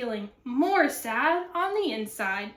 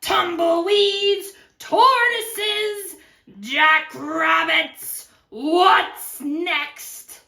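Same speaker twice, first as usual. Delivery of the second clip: slow, loud, high grumbling list; exasperated; 4 semitones higher, 7 dB louder, much slower than usual. Acted. Cactus fed up with visitors, loudly exasperated. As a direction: stretch out each pest in exasperation.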